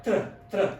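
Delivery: just the "tr" sound, cut off before the vowel; isolated consonant blend. Shown as a demonstration. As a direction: demonstrate tr on its own. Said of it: "this is pronounced tres".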